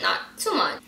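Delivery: normal talking voice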